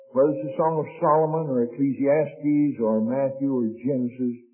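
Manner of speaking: ordinary talk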